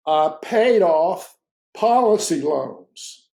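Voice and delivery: slow, loud, weighty reveal. Retold or imitated. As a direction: drop the answer slowly and firmly. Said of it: "He says".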